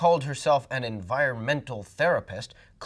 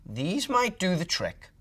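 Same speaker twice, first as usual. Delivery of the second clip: a kindly granny diagnosing; old woman; 6 semitones higher, slower than usual. A narrator's reading.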